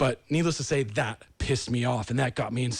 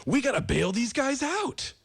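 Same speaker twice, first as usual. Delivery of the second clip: mock officials; 10 semitones higher, slower than usual. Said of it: "officials are saying".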